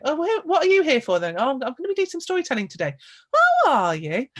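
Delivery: old women quiz the storyteller, lightly imitated; chatty old women. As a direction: mimic nosy old women in the rain.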